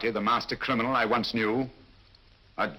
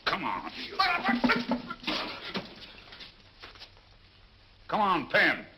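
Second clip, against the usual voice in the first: rough foreign sailor, higher pitched; disguised sailor voice; 10 semitones higher than usual. Acted. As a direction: growl it like a dockside thug. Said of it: "An admirable disguise".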